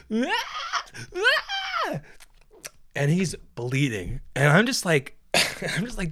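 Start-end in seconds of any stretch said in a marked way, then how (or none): 0.1-2.0 very high mock squeals; hammed-up screaming, held back in volume; overreacting kid's scream. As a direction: imitate a kid wailing over nothing. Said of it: "fucking kids overreact to everything".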